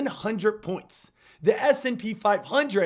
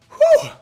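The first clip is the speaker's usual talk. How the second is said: excited whoop; 10 dB louder than usual. Real, not acted. Hype exhale after opener.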